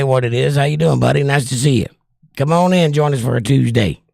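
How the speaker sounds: normal talking voice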